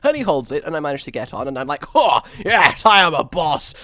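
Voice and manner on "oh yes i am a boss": retold triumph. Retold triumph at catching train.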